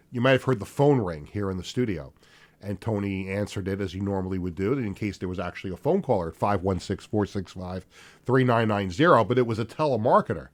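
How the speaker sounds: ordinary delivery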